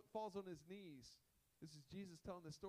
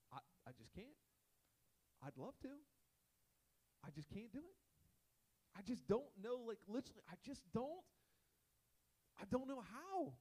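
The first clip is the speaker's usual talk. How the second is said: mock despair; 6 semitones higher than usual. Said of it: "you're just like".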